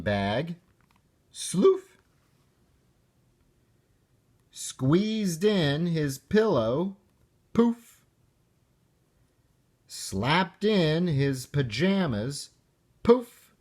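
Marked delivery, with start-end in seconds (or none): 1.3-2.0 high, swooping "Sloof!" like a cartoon noise; playful sound effect. Sound effect of sleeping bag. 7.5-8.0 high, bouncy "Poof!"; playful sound effect. Acted. 13.0-13.5 high, louder "Poof!"; playful sound effect. Acted.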